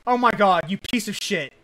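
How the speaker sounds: furious outburst at the screen; angry yell. Real, not acted. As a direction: yell it in real frustration. Raging at game opponent.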